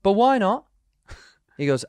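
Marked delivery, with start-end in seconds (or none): 0.0-0.6 mock British accent, pitched up; puzzled British driver in a mock accent. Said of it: "the accent you just did".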